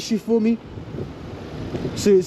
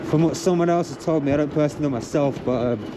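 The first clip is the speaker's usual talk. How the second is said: ordinary talk, nothing marked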